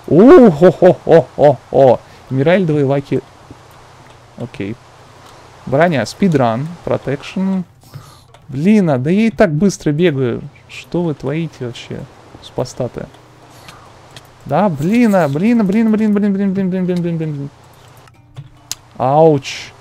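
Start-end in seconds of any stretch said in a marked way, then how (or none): 0.1-2.0 slow loud gloating ho-ho-ho; gleeful chuckle. Real, not acted. Loot drops excitedly. 14.4-17.5 frantic cursing. Real, not acted. Panic under attack.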